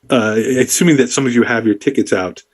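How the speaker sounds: ordinary talk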